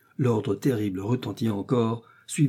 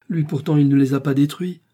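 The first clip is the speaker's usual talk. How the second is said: higher, louder fervent defence; exclaimed protest; 5 semitones higher, 7 dB louder than usual. A narrator's reading. Lucie defending the colonel to Lorenzo, exclaimed protest. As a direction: jump in to defend him, loudly. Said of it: "s'écria Lucie".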